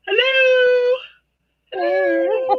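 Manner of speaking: very high sing-song hello; Flosstube voice. Shown as a demonstration. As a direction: put on a high, sugary YouTuber greeting. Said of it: "There's my floss tube voice".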